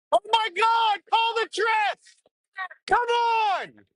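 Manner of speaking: outraged high-pitched yell; shouting. Real, not acted. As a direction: scream at the missed call. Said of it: "Sorry for the shouting".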